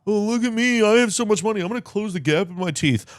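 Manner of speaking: high, mocking boastful whine; mock a rich athlete bragging about his money; smug rich athlete. Mocking Aaron Judge, a high bragging whine. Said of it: "That Aaron Judge is a douchebag?".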